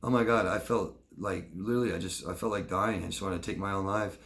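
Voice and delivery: no affect. Shown as a demonstration. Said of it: "No affect".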